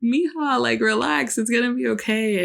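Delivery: high sing-song coaxing to a newborn; soothing the baby. Retold or imitated. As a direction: coo it high to calm a fussy baby. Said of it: "And I'm like".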